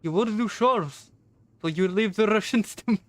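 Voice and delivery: lightly acted Russian accent. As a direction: put on a Russian accent for the character. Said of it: "You're really, really good at it".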